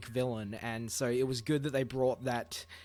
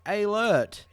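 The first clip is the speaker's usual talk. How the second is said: mock announcer; 6 semitones higher, 4 dB louder, much slower than usual. Announcing spoiler warning.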